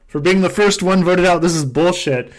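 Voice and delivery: loud, hammed-up indignant rant; mock outrage. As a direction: fake fury at being voted out first. Rants about being voted out first.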